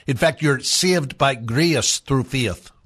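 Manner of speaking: slow Irish lilt, "grace" and "faith" as ee-uh; Irish accent. Shown as a demonstration. Host proving how easy it is, slow Irish accent. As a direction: say each long A as an Irish "ia". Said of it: "You replace A with ia".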